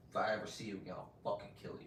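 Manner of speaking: soft menacing near-whisper; quiet threat. Retold or imitated. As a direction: hiss the threat low and soft. Quoted death threat.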